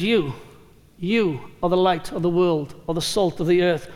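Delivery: "You" repeated after a pause, singled out; emphatic YOU. Retold or imitated. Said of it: "It's not a general thing".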